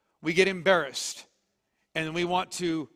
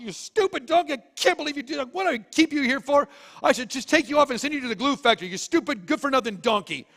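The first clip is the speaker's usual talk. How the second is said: higher-pitched, fast, ranting scold; furious Balaam; 7 semitones higher, faster than usual. Acted. Balaam beating his donkey, in a high furious rant. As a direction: rail at the donkey like you've had it. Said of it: "Balaam has just had it".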